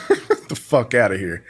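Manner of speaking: laugh, then wave them off; scornful laugh leading into the words; laughing dismissal. Real, not acted. Co-guest scoffing at a streamer's complaint, laughing dismissal.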